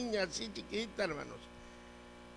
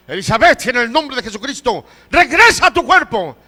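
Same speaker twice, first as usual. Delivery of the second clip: high, loud, forceful command; re-enacted shouted prayer, building at the end; 7 semitones higher, 17 dB louder than usual. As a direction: order her back with full authority. Re-enacts brother's command to the girl.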